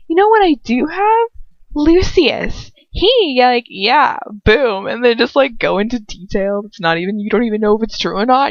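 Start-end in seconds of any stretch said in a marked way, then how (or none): 0.1-4.9 high, loud taunting brag; mock taunt. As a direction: gloat over the villain, pitched up. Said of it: "you just be like".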